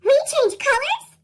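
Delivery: high, loud eager question; a leaf thrilled by news of changing colours; excited Birch. A narrator's reading. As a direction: burst out, ready for adventure. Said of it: "Birch said, ready for adventure".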